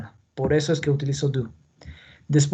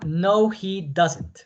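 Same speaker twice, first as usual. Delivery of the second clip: drawn-out, careful answer; teacher modelling a negative answer very slowly; very slow model answer; much slower than usual. Shown as a demonstration. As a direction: stretch every word for the class. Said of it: "Es respuesta negativa".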